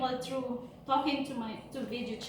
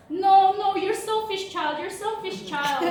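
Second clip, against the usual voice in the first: mother's sharp reproach, higher and louder at the start; scolding mother; 6 semitones higher, 5 dB louder than usual. Retold or imitated. Mother scolding her daughter over money, a mock angry tone. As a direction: scold your selfish child.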